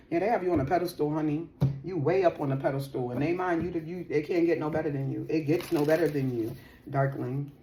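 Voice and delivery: normal talking voice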